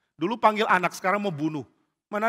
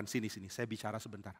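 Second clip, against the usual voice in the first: low, soft and secretive; quiet, lowered voice; hushed aside; 6 semitones lower, 14 dB quieter than usual. Acted. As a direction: pull someone aside quietly. Daud membisiki temannya di perkemahan.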